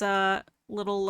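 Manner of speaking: usual speaking voice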